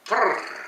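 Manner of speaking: frrrk with a long rolled R, high; frrrk, R rolled like a Scot. Shown as a demonstration. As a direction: trill the R in frrrk. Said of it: "roll your R's".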